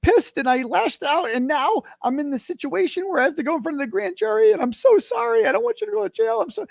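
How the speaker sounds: imitating a woman's high-pitched tearful apology; mock crying. Woman on the phone apologizing to him, imitated crying. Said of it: "she was crying and she's like".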